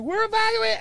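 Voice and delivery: high, urgent alarm; wife on phone, house evacuating, panicked. Retold or imitated. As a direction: mimic the wife's frantic news. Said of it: "my wife's like".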